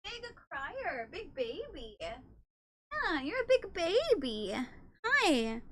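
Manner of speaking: soft, high teasing coo to a pet; cooing fondly at a crying cat. Real, not acted. Soft baby talk to cat.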